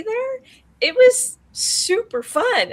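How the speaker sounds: high, slow and delighted; excited. Real, not acted. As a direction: gush about how fun it was. Excited about meeting fellow finalists.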